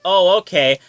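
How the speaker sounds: a high, loud, eager oh okay. Retold or imitated. Gendry answering Davos with an eager okay. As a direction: jump in, keen to agree.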